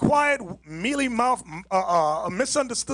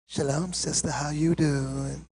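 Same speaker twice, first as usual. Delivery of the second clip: much lower and softer, slow and gentle; soft-spoken brother; 9 semitones lower, 6 dB quieter, slower than usual. Retold or imitated. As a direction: mimic the meek church boy's soft greeting. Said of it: "the brother that talks like this".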